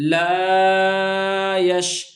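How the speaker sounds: لا یش with the la of لا held very long; drawn-out vowel. Shown as a demonstration. Teacher stretching the لا of لا یش for the class. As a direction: stretch لا slowly, then say یش. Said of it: "ہم نے یہاں لا کو بھی کھینچا".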